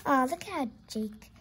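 plain reading voice

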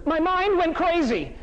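slow, high-pitched dramatized dismay; mock outrage. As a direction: stretch it out in theatrical disbelief. Acts out outrage at losing server.

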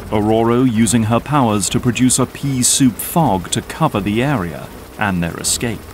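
normal talking voice

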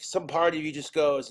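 normal talking voice

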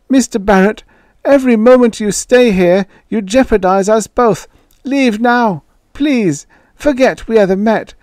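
put-on high, loud pleading old voice; pleading old man. A narrator's reading. Ewing begging Joey to leave, high pleading. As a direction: beg in a frail high voice.

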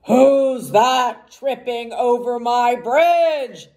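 loud, slow troll roar. A narrator's reading. Troll challenging whoever is crossing his bridge, roaring. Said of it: "roared the troll".

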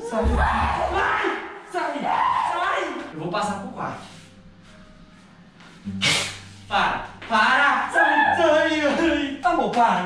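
high panicked shouts; panicked yell. Real, not acted. Panicked yells at the zombie.

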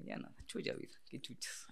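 breathy whisper of giving in; hushed what-the-hell whisper; whispered thought. Retold or imitated. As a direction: whisper it like a private shrug. Reckless inner thought, quietly voiced.

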